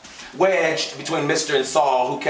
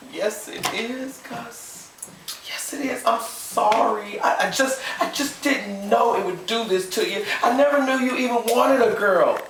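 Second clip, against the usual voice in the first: tearful apology; 12 semitones higher, slower than usual. A narrator's reading. Emma Jean apologising tearfully.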